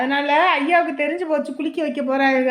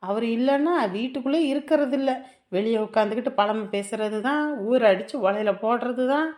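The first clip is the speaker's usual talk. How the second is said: low, muttered complaint; soft, lowered private grumble; grumbling aside; 5 semitones lower, 7 dB quieter than usual. Acted. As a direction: mutter to yourself about the mother-in-law. Daughter-in-law grumbles aside about mother-in-law.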